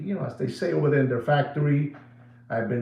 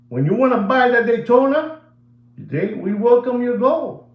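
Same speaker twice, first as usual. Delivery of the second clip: mocking dealers; 9 semitones higher, 5 dB louder than usual.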